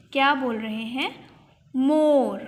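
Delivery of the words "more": "मोर" loud, with a drawn-out aw vowel; teacher modelling the vowel in "मोर"; emphasised O sound. Shown as a demonstration. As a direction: hold the middle of "मोर" for the class. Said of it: "ओ की sound आ रही है".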